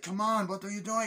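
high-pitched imitation of alarm; mock panicked protest. Passenger during the plane attack, high mock panic.

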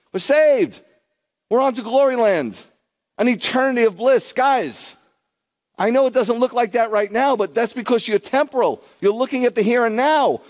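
high, loud impassioned preaching; emphatic tirade. Real, not acted. Preacher urging believers to look past the here and now, emphatic tirade. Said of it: "emphatic little minor tirade there".

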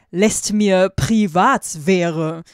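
loud, drawn-out exclamation; slow, loud cry of protest; calling out. A narrator's reading. Heroine demands privacy from the boys, calling out loudly. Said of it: "Rief ich auf".

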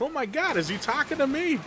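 high, gushing starstruck fan imitation; starstruck viewer. Streamer mocking shocked viewers in a high gushing voice. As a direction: squeal it like a stunned fan. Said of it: "They're like".

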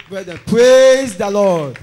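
very loud, slow, drawn-out praise call; rally the church, stretching every word; rousing call. Real, not acted. Ending his testimony to the brethren, a loud drawn-out call. Closes testimony calling congregation.

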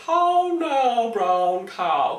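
loud, high mock posh English; hammed-up posh English like The Nanny; Nanny's fake posh English. Imitating The Nanny trying posh English. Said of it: "she was going".

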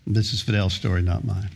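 ordinary talk

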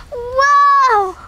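high gasp, loud whoa; surprised gasp. Pretend. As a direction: gasp then shout in amazement. Finding the surprise.

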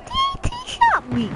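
very high, squeaky silly voice. Pretend. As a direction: squeak it out like a cartoon. Same words repeated in silly voice.

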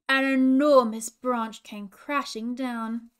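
slow, dramatic storybook telling that swells loud; reading a tense story aloud to little ones; story narration. A narrator's reading. Princess reading children a story, slow and building.

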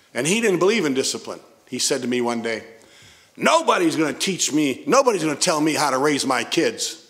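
ordinary talk, nothing marked

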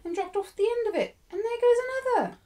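very high, loud, pointed teasing; taunting schoolgirl. A narrator's reading. Jessie watching Ermengarde's tears fall, taunting. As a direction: crow over each detail in a high child's voice.

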